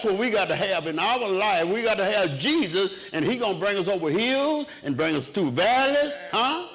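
fervent preacher lifting the whole church; preaching at full force. Acted. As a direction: build the sermon up to a high ringing cry.

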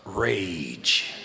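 normal talking voice

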